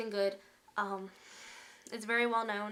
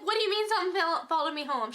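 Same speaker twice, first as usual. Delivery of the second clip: alarmed; 6 semitones higher, 4 dB louder, faster than usual. Retold or imitated. Alarmed question to psychic.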